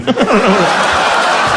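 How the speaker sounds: helpless burst of laughter; laughing. Real, not acted. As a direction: crack up at your own line. Cracking up at own line.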